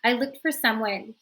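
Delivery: usual speaking voice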